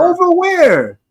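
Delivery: high, loud, drawn-out disbelief; incredulous. Real, not acted. Co-host challenging the claim Melo is over, incredulous. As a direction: fire it back high and incredulous.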